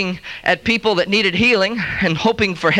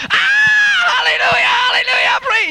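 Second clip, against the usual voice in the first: much higher, louder hallelujah cries; ecstatic crowd shout; 3 dB louder than usual. Acted. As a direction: whoop it up like a revival crowd gone wild. Crowd cheers the second crutch.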